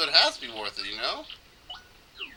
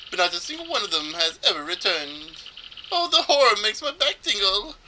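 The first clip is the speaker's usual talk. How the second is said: hammed-up, higher-pitched trader telling a legend; fey storyteller; 6 semitones higher, 4 dB louder than usual. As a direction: relish the tale in a theatrical, fey voice. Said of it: "make this guy sound as fey as possible".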